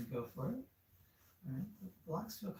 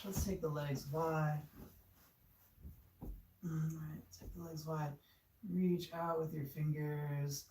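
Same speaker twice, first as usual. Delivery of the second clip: ordinary talk, nothing marked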